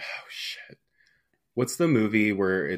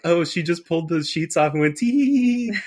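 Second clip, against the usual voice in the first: witch voice; 9 semitones higher, 4 dB louder than usual. Retold or imitated. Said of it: "I was like".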